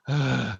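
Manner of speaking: real, audible sigh of annoyance; exasperated sigh. Real, not acted. Reviewer fed up with annoying narration, exasperated sigh. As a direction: let out a fed-up breath.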